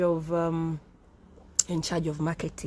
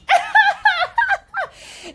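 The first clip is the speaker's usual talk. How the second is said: real laugh; 8 dB louder than usual. Real, not acted. Laughs again after joke.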